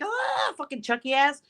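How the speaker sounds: high-pitched mock scream; mother retelling yelling at her son; retold scream. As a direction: yelp in pain at the biting toddler. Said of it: "and I screamed".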